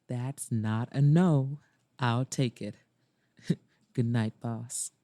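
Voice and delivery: low male voice with a small chuckle; grinning suitor. A narrator's reading. Maxwell saying good night to his boss, grinning low voice. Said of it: "His grin widened".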